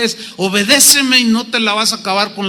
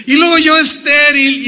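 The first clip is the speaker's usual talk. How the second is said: higher, louder comic disbelief; mock incredulity; 6 semitones higher, 5 dB louder than usual. Sara hears she will bear a son, mock incredulity. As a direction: protest the absurdity in a raised voice. Acting Sara's incredulous reaction.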